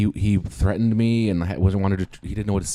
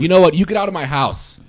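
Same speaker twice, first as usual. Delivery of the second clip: higher, louder furious shout; angry yell; 10 semitones higher, 9 dB louder than usual. Acted. Husband shouting the thug out of his house. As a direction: throw him out of the house.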